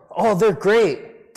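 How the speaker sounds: slow, drawn-out mock gush; hammed-up gushing. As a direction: stretch it out like a fawning fan. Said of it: "We go from".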